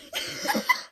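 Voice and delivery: very high breathless wheeze laugh; high airless wheezing laugh; high wheezy laugh. Real, not acted. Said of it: "That's actually how I laugh".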